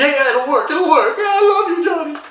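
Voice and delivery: wife reassuring Johnny, high and giggly; gushing wife. Acted. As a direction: squeal it out giddy and lovestruck. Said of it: "And so she's like".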